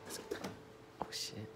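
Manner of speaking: very quiet, sneaky whisper. Real, not acted. Vlogger sneaks off to check on friend, whispering. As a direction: whisper it while creeping up on someone.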